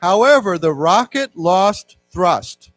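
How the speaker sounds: slow, loud, clear reading; reading aloud for class. Shown as a demonstration. Teacher reading the text aloud to the class, slow and loud. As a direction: read it out slowly for students to follow. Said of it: "let's read this together".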